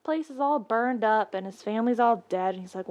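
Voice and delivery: ordinary delivery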